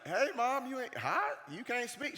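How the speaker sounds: high-pitched playful fake offence; mock-innocent stalling. Son dodging his mom's demand, mock-innocent stalling.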